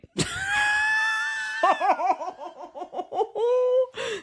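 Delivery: high, shrieking burst of laughter; real laughing shriek. Real, not acted. Big laugh after punchline.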